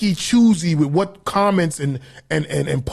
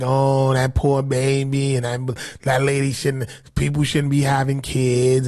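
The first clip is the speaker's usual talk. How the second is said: slow, lowered mock-sympathetic drawl; mock pity; 5 semitones lower than usual. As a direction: imitate commenters cooing over a poor baby. Mocking commenters' pity for child.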